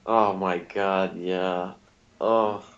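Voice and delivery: normal talking voice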